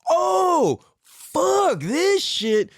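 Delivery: very high, drawn-out mock yell; acted outrage. Seeker attacking his ego, acted outrage. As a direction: stretch each word in high-pitched revolt.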